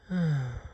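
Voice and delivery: soft tired sigh; weary sigh. A narrator's reading. Mole sighing wearily, a soft tired sigh. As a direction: breathe out the tiredness. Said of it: "Mole sighed wearily".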